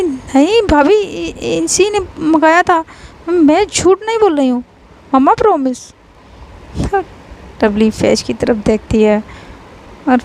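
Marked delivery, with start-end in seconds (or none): 0.0-5.9 high, pleading stammer; frightened pleading. A narrator's reading. Lovely pleads with her sister-in-law, high and frightened. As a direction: beg the sister-in-law to believe you.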